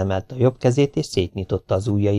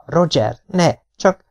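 higher-pitched, mildly shouted protest; mother's shout; 6 semitones higher, slower than usual. A narrator's reading. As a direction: cry out in protest, not full volume. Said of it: "Anya ordított". (magasabb hangú, tiltakozó anyai kiáltás)

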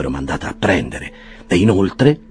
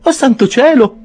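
very high, louder cry; startled waking; 7 dB louder than usual. A narrator's reading. Mrs Bardell waking in the carriage, high startled cry. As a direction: wake with a start. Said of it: "disse la signora".

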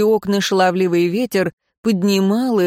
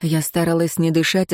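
plain reading voice

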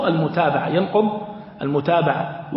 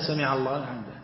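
سمع الله لحمده said much softer and lower; soft follower's echo; quiet follower; 5 semitones lower, 8 dB quieter than usual. Shown as a demonstration. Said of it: "قال الإمام".

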